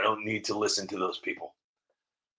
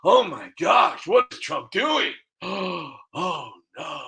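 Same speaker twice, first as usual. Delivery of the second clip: mock shocked viewer; 6 semitones higher than usual. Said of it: "until you finally say".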